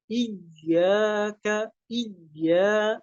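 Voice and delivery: "Iyyaka" slow, doubled y pressed and a kept thin; smiling "ya". Shown as a demonstration. Teacher models thin ya, smiling "Iyyaka". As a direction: smile through the "ya" of "Iyyaka". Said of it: "dengan tersenyum".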